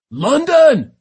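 very loud, high-pitched call; urgent shout. A narrator's reading. First mate to London as leapers close in, shouting. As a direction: shout the name across the distance. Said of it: "he shouted".

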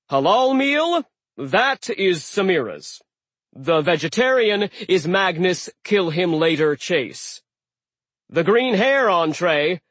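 loud, high announcing giant; host calling orders. Acted. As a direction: call out each order. Aegir serving.

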